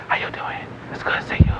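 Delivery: barely audible whispered small talk; low talker. Acted. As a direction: imitate someone who talks far too softly. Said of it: "They low talk you to death".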